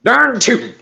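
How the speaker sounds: hammed-up, high-pitched Southern yokel; exaggerated Southern cowboy whoop. Shown as a demonstration. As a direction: overdo a cartoon Southern accent to warm up. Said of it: "If I really wanna get into the accent".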